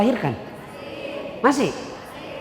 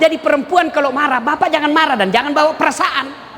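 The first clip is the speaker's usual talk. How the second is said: ordinary talk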